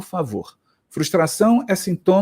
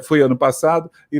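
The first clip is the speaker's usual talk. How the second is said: normal talking voice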